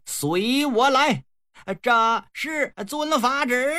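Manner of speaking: put-on high, slow, lofty command of an old master; a lightly voiced solemn Taoist elder; posing as the old sage. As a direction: intone it slowly like a grand elder leading the way. Yang Jian posing as Laojun.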